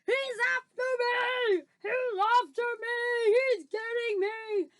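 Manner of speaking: high, loud, slow wails of terror; panicked screaming. Acted. Giant thrashing in a nightmare, screaming in panic. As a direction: wail each cry, drawing out the end. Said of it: "screamed the flesh lump eater".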